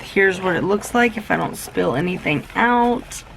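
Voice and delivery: ordinary talk, nothing marked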